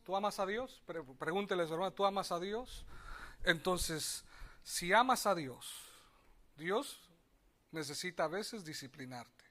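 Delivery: ordinary delivery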